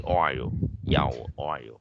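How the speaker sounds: "oil" as "oi-ol", the L rounded like "o"; final dark L. Shown as a demonstration. Teacher giving a similar word as example, dark L ending. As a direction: sound the dark L at the end of "oil". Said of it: "có âm L cuối này nó hơi có âm ồ".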